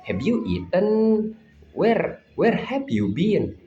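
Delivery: slow, careful Indonesian-accented English; local Indonesian accent. Shown as a demonstration. Teacher demonstrating local English to students, Indonesian accent. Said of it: "when I talk like, uh, local".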